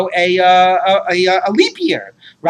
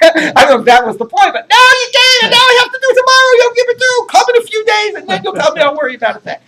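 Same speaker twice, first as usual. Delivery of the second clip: much higher, breaking into laughter; mock indignant retort; 14 semitones higher, 5 dB louder than usual. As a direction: ham up the rabbi's exasperated scolding while laughing. Rabbi's mocking retort, retold.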